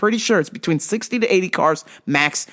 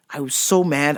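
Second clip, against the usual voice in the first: ordinary talk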